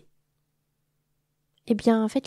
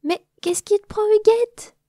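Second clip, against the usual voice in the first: much higher and louder, alarmed; panicked cry; 14 semitones higher, 6 dB louder than usual. Acted. The little bee shoved into the pond, crying out in panic. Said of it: "cria la petite abeille affolée".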